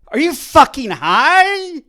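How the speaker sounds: incredulous yell. Retold or imitated. Retold comeback to the nurse, loud slow incredulous yell. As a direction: drag out the outrage.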